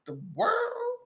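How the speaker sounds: high, soft mock bewilderment; hushed disbelief. Artist reacting to a controlling boss, hushed disbelief. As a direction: gasp it quietly in disbelief. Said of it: "And you're like".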